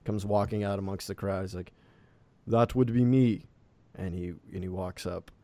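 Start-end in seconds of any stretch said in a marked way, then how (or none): 2.5-3.5 louder, lightly voiced village elder; elderly half-elf. Elderly half-elf answers from amongst the crowd, lightly voiced elder. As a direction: announce yourself as the elder, voice raised a little. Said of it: "a elderly half-elf".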